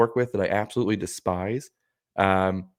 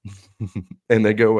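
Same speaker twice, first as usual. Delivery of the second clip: ordinary talk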